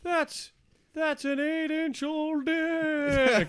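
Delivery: ghost voice. Pretend. Ghost voice commenting.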